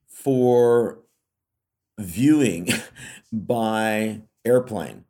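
usual speaking voice

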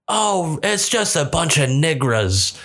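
high, slow mock dismissal; imitated old lady. Old wife answering her husband, mock dismissive.

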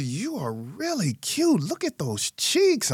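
high, slow, doting coo; cooing to a child. Retold or imitated. Man coos to his little sister in high slow tones. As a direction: dote on a cute little girl.

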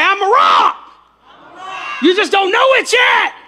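rally the congregation at full voice; preacher urging everyone to yell along; rousing shout. Real, not acted. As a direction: shout it high and loud to the crowd. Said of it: "you'd have been seven times louder".

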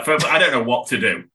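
usual speaking voice

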